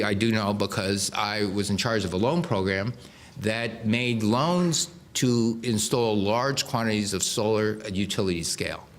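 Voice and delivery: normal talking voice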